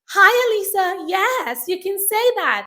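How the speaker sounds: put-on American accent for teaching kids. Shown as a demonstration. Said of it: "automatically it becomes American".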